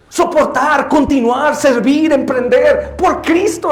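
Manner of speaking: high, forceful preaching; impassioned preaching. Real, not acted. Preacher exhorting the congregation to adore Christ, impassioned preaching.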